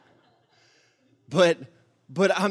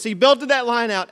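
ordinary talk

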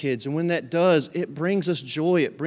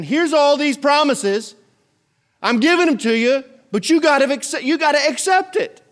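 much higher, louder proclamation as God; God's offer; 9 semitones higher, 5 dB louder than usual. Acted. God offering his promises to everyone, loud and high. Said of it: "God saying".

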